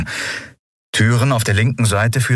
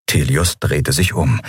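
usual speaking voice

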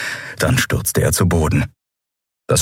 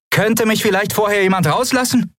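urging; 13 semitones higher than usual. Acted. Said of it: "drängte Lien".

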